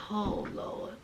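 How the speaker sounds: very low, whispered dismay; dismayed aside. Retold or imitated. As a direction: breathe it out under your breath. Own dismayed aside, retold.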